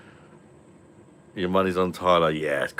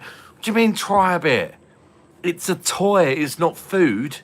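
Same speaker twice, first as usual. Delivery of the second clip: higher and louder, not slowed right down; retold outrage; 10 semitones higher, 5 dB louder than usual. Dad telling kids a toy is not food, louder and higher. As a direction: scold them in disbelief. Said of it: "retold reply to the kids".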